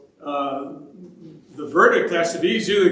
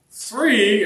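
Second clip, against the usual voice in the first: higher, mock-puzzled echo; questioning echo; 4 semitones higher than usual. Teacher echoing preschoolers' wrong answer, mock surprise. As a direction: throw the children's answer back in surprise. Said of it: "And I went".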